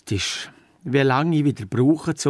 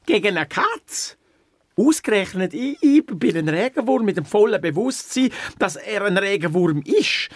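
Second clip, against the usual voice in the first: indignant disbelief; 6 semitones higher than usual. Acted. Worm protests fighting a cat.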